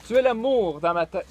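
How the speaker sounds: high, loud declaiming; projected, stagy verse reading; poetry recital. Acted. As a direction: proclaim the love line to the room. Another attempt at the poem.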